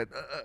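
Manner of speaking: short stuck "uh, uh" sounds; brief imitated block on "uh"; stutter demo. Shown as a demonstration. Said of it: "it's not always like a".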